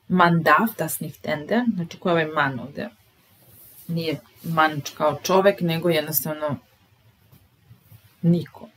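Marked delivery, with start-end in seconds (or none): none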